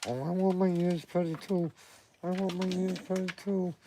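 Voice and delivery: high put-on dog voice; voicing the dog. Owner voicing his dog's jealous wish in a high voice.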